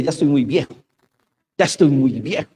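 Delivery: lamento medio teatral, más fuerte; queja exagerada. Retold or imitated. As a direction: quejarse en broma de la vejez. Se queja de estar viejo. (queja de viejo, medio en broma)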